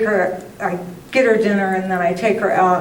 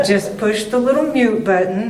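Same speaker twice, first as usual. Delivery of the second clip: ordinary talk, nothing marked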